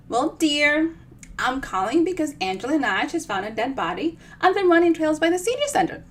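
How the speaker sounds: sunny and unbothered, reporting the body like it's news of the day; cheery old lady Minnie. Acted.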